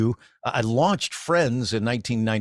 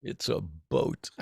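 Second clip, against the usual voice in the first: Riot's line, deadpan; 5 semitones lower, 7 dB quieter, slower than usual. Retold or imitated. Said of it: "most ridiculous thing he ever said".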